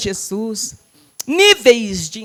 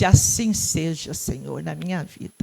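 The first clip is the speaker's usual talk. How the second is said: quiet prayer; 7 semitones lower, 6 dB quieter than usual. Retold or imitated. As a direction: pray it softly, as if alone with God. Her own prayer, retold. (oração baixinha e lenta)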